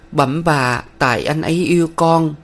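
put-on pleading girl. A narrator's reading. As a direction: beg humbly, like a frightened young woman.

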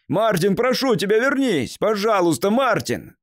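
high, loud, desperate begging call; pleading shout. A narrator's reading. Man begging a fleeing boy to return, shouting. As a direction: shout after them, begging them to come back. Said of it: "крикнул ему вдогонку Слоун".

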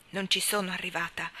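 plain reading voice

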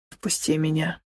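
soft low whisper close up. A narrator's reading. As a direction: breathe it into her face. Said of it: "прошептал Михаил".

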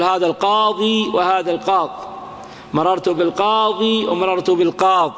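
القاضي then القاض, the final ي kept then dropped; إثبات الياء ثم حذفها; with and without ya. Shown as a demonstration. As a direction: contrast القاضي with a clipped القاض. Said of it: "جاز إثبات الياء وحذفها".

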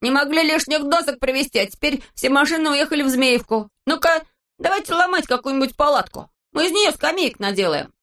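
high-pitched indignant character voice; high, bossy outburst of annoyance; vexed shouting. A narrator's reading. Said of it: "кричал он".

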